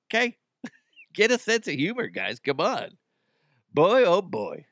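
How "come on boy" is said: ordinary delivery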